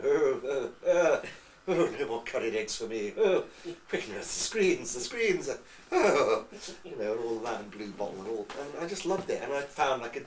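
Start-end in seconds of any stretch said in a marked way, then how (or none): 0.0-3.1 loud comic radio character groans; Goon Show voices. Retold or imitated. Fan replaying the Goon Shows by himself, comic character voices. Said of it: "doing all the voices". 3.2-6.4 loud, higher comic character cry; mock-alarmed radio comedy character, pushed loud; Goon Show voices. Fan replaying a Goon Show sickroom gag, comic character voice. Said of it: "doing all the voices".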